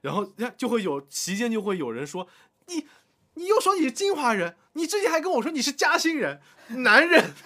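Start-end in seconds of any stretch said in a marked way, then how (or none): 2.7-7.3 high loud teasing jab; mocking accusation. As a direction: tease him high and loud. Colleague mocking at dinner.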